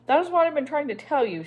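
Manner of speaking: finally bursting out, exasperated. A narrator's reading. Annabel insisting to Miss Priscilla, loud and exasperated. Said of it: "she said with exasperation".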